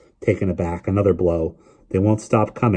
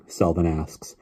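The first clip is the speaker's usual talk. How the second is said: usual speaking voice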